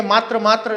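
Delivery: "मातृ" as maatra, ending in tra; common mispronunciation. Shown as a demonstration. Teacher quoting what many are saying, "मातृ" as maatra. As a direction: say maatra for "मातृ", a vowel at the end. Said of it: "बोल तो बहुत सारे रहे हैं".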